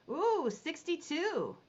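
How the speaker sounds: high, drawn-out ooh of delight; pleased surprise. Real, not acted. Auctioneer surprised by a last-second bid, high drawn-out ooh. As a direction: caught off guard by a last bid, stretch it out. Late bid arrives after sale call.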